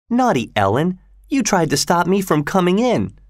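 high-pitched mock reproach; playful scolding. A narrator's reading. Kathy chiding Ellen for stopping her, playfully. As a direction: chide lightly in a lifted voice.

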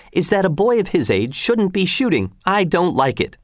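a hint of a nagging housewife objecting; fretful mother. Mother scolds about shooting.

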